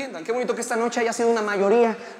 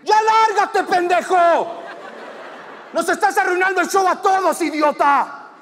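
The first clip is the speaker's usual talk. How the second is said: high, loud, full-out angry yell; furious shout; 8 semitones higher, 5 dB louder, slower than usual. Acted. Rescuing audience member yelling at the attacker, furious shout. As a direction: bawl it right into his ear. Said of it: "le alcanzó a gritar en la oreja".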